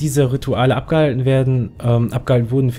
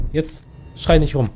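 ordinary talk, nothing marked

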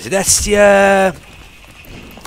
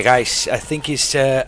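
ordinary talk, nothing marked

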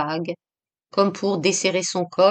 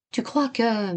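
hushed, hesitant question left hanging; low, fearful voice; 3 semitones higher, 4 dB quieter, slower than usual. A narrator's reading. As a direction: barely dare to ask. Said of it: "la voix basse, dans une peur folle". (voix basse, pleine de peur)

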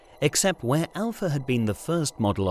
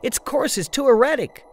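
much higher, louder desperate cry; desperate yell; 4 dB louder than usual. Acted. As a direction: yell it over the failing controls. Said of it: "Anne yelled desperately".

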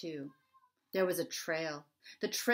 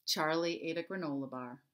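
usual speaking voice

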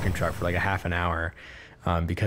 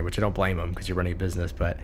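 normal talking voice

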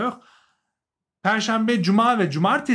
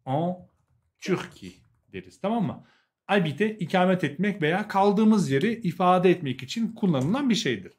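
ordinary talk

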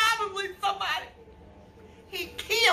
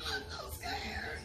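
terrified whimper; 11 semitones higher, 12 dB quieter, slower than usual. Acted. Scared woman, whimpering.

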